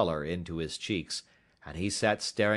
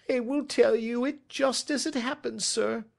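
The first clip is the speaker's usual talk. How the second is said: higher-pitched, submissive culprit voice; cowed culprit; 4 dB louder than usual. Acted. Culprit gives in to the detective, cowed and higher-pitched. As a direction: give in and agree to confess.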